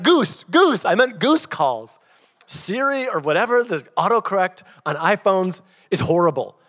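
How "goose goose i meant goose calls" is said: loud, insistent comic clarification; frantic correction. Retold or imitated. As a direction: blurt it high, then drag out the fix. Retold hurried correction of the text.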